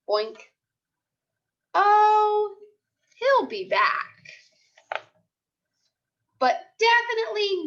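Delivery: put-on lively storybook voice for young kids; picture book read-aloud. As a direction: read it like a class story time. Teacher reads picture book aloud.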